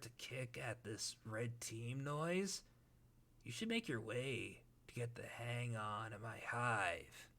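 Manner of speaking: Tavros voice. Acted. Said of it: "Gamzee slash Tavros".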